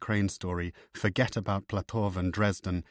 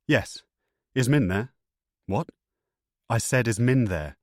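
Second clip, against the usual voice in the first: louder, sharp and insistent; impatient and urgent; 3 semitones higher, 5 dB louder than usual. A narrator's reading. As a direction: demand an answer, tense. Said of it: "Nick wasn't taking too kindly to Gaddis's tone".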